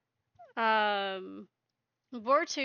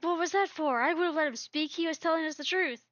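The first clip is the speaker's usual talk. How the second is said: higher, louder indignant protest; 6 semitones higher, 4 dB louder, faster than usual. Retold or imitated. Cat protests Boartooth silencing Stormfang, higher and indignant. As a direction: object loudly, indignantly.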